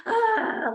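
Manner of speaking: high strange cry ah; weird high ah. Retold or imitated. Retelling the strange new voice, high ah. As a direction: let out an odd high ah. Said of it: "a voice I've never heard".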